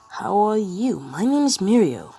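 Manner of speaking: high, loud, bouncy hello; cheery greeting. Acted. Upbeat kid introduces himself.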